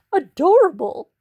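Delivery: high, loud squeal of "adorable". A narrator's reading. Missus High Horse squealing over the dog, high and loud. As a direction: squeal it with delight. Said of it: "squealed Missus High Horse".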